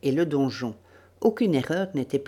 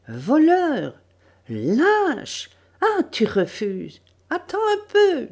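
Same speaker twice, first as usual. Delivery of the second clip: high, loud abusive cries; hurling insults; 12 semitones higher, 4 dB louder, slower than usual. Acted. Shrewish woman abuses the bound captive, hurling insults. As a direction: shriek accusations at a captive, pitched far up. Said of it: "apostrophait ce sixième individu".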